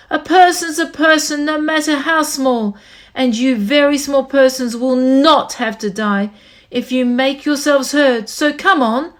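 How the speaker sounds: rally them with all your heart; calling out. Acted. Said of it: "he managed to call to the mayor".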